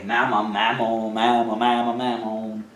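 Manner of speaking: mammon repeated low and soft, running together; practising tricky word. Real, not acted. Preacher trying out a word that is hard to say, low repeated mumbling. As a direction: mumble mammon over and over, testing the tongue. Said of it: "it's hard to say".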